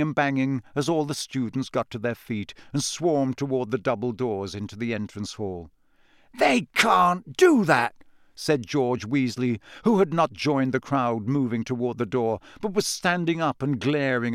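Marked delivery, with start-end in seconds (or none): 6.4-7.9 high, drawn-out, louder outrage; aggrieved teenage protest; indignant protest. A narrator's reading. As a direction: object hotly to an unfair rule. George glaring, protesting at the rule.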